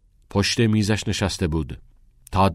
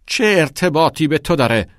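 much higher, much louder angry outburst; furious shout; 10 semitones higher, 9 dB louder than usual. Acted. Father shaking his fist at his son, shouting furiously. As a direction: explode at the boy.

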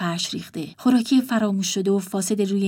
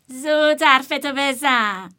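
impatient; 5 semitones higher, much slower than usual. Acted. Impatient brother.

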